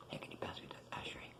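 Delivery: barely audible breathy whisper. Shown as a demonstration. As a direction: hush it like a paranoid guy on meth. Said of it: "I'd be like this".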